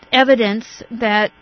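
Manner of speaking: ordinary talk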